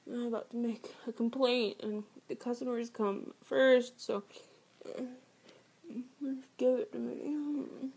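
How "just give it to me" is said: very slow pleading whisper. Acted. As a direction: stretch it out in a whisper.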